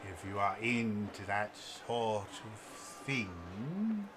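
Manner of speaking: put-on very slow, drawn-out old merchant; elderly elf shopkeeper. Old elf presents his wands to a customer, slow and theatrical. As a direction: linger on each word, savoring the wares. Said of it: "elderly, um, elven-looking person".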